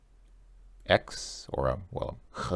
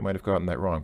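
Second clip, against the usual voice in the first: usual speaking voice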